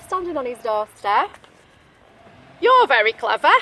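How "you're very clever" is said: louder coaxing praise; butter him up; a hint of wolf flattery; flattering wolf. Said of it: "said Mister Wolf".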